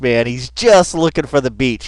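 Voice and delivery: ordinary delivery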